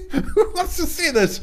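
high squeaky laugh through the words. Real, not acted. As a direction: laugh it out, amused at yourself. Laughing at his own looks.

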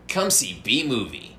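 a hint of a movie trailer voice; kids trailer voice. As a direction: pitch it like a family film trailer. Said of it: "comedy kids trailer".

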